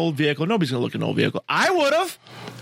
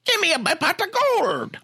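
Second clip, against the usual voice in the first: imitating the leprechaun; 11 semitones higher than usual. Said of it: "He goes".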